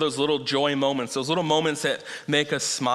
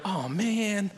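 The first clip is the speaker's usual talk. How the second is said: higher mock groan of letdown; mock disappointment; 6 semitones higher, 3 dB quieter than usual. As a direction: play the let-down parent, half in jest. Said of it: "and we're gonna".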